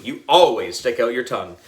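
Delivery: usual speaking voice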